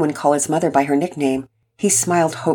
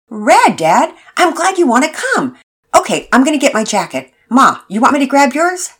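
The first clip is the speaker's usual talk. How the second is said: put-on higher, eager boy voice; a hopeful kid pleading with his mom; teen son; 9 semitones higher, 6 dB louder than usual. Son asking his mother, higher eager boy voice.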